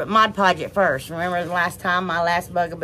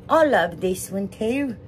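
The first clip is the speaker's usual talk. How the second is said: slow, hammed-up British accent; bad British accent; slower than usual. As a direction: attempt a British accent and miss. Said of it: "That was a bad British accent".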